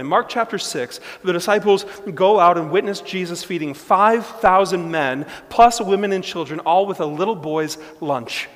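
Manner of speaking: loud, fast, fervent sermon; preaching. Acted. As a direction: build the story with rising fire. Preacher at full force.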